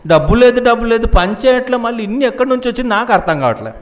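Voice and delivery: high, loud, baffled grumbling; retold indignant complaint. Complaining there is no money and no work, high indignant retelling. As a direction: act out an exasperated money grievance.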